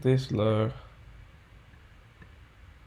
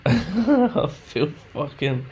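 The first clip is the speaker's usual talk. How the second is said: rage laugh; 4 semitones higher, 3 dB louder, much slower than usual. Real, not acted. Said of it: "I just feel rage".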